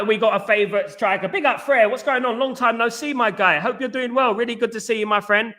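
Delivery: normal talking voice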